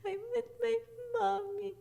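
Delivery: put-on very high, squeaky child voice; imitated tearful tot; little tiny voice. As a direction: whimper it like a lost small child. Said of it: "like this little tiny voice".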